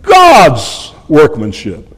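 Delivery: slow, "God's" drawn out with a falling pitch; stress on God's. Shown as a demonstration. Preacher repeating the front-loaded word slowly, "God's" drawn out. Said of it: "a word by front-loading it".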